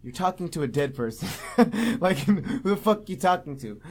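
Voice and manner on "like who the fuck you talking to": laughing through a question; real amused disbelief. Real, not acted. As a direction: giggle it out in disbelief. Laughing at the movie.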